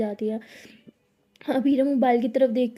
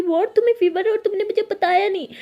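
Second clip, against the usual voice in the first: much higher and louder; shocked; 7 semitones higher, 5 dB louder than usual. A narrator's reading. Anokhi shocked Abira hid her fever, loud. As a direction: burst out in disbelief.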